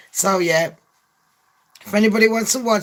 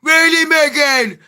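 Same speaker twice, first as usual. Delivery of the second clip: high, loud gullible prince; hammed-up eager questioning; Prince Harry; 7 semitones higher, 4 dB louder than usual. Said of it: "And Harry's like".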